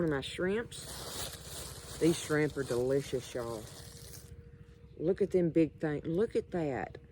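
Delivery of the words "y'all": usual speaking voice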